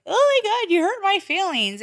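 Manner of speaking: high whiny mock hurt voice; mocking sensitive woman. Telling friends how sensitive women react, mock whiny and high. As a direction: parody someone overly offended. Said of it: "they're like".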